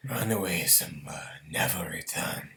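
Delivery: low hissed whisper; sinister villain whisper; Scar voice. Retold or imitated. Scar quote from Lion King.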